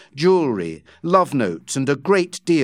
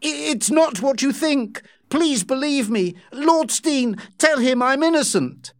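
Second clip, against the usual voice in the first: pleading; 11 semitones higher than usual. Acted. Becky pleading, caught out.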